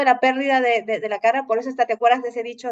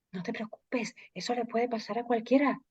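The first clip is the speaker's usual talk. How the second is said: softer, hushed voice of a coworker; susurrando; 10 dB quieter than usual. Retold or imitated. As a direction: keep it hushed, like a secret. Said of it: "pero ¿por qué susurras?". (susurro bajito de la compañera)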